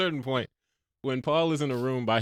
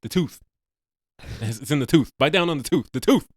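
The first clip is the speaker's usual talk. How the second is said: mock-urgent, louder repetition; mimicked movie line; 4 semitones higher, 8 dB louder than usual. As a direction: hammer the repeated words in mock drama. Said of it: "Just the way that they kept saying it".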